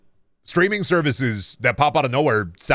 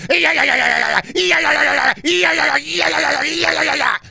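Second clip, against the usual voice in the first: much higher and louder, frantic repeated yeah; hyped teen gamer yell; 5 dB louder than usual. Retold or imitated. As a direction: yell like a kid wired on energy drinks. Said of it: "I'm literally going".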